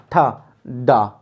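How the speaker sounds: ठा with a puff of breath, डा unaspirated, both slow; aspiration demo. Shown as a demonstration. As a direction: stretch ठा, डा slowly to show the air. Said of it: "ढा में अधिक आती है".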